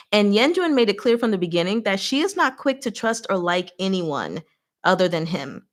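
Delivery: usual speaking voice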